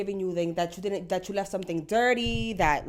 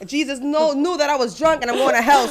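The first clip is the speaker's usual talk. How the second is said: higher, louder panicked rush; panicked outburst; 7 semitones higher, 10 dB louder than usual. Retold or imitated. Imagining a crash, a loud panicked retort. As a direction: blurt the fear of hell out loud. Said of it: "And it's like".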